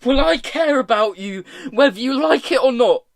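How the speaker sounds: high, loud, heated insistence; building angry yell; screamed. A narrator's reading. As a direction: scream it back stubbornly. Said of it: "Tom screamed".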